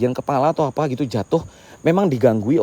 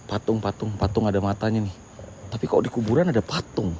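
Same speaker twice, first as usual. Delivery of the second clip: much softer, wary talk; hushed; 7 semitones lower, 12 dB quieter than usual. Real, not acted. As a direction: keep it low beside the statue. Hushed at cemetery statue.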